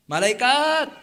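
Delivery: a sudden loud cry of "Malaikat"; loud call to the angels. Acted. Poor man calls out loudly to the angels to stop. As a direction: shout it out to stop them.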